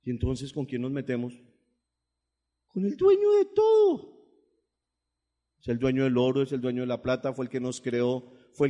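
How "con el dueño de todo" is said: high, loud ringing declaration; high triumphant cry; exultant proclamation. Acted. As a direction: lift it like a shout of praise. Exalting God's greatness.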